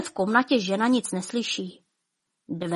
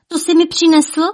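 high, loud, thrilled exclamation; delighted cry; 8 semitones higher, 7 dB louder than usual. Acted. Wife crying out in delight at the veil. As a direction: gasp with joy at the veil.

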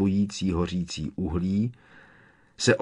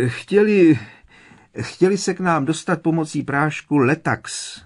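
holding back laughter; 8 semitones higher, 6 dB louder than usual. A narrator's reading. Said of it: "potlačoval touhu bláznivě se rozchechtat".

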